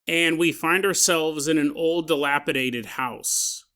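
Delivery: usual speaking voice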